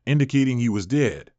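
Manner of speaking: usual speaking voice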